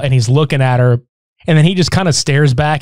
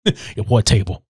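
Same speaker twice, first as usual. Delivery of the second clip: low mock bully voice; 6 semitones lower, 5 dB quieter than usual.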